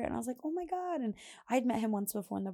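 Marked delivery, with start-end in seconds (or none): 0.4-1.0 delighted surprise. Retold or imitated.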